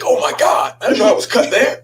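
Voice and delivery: high startled exclamation, laughing through it; suddenly alarmed; shocked cry. Retold or imitated. Telling the congregation about the shower, startled and laughing.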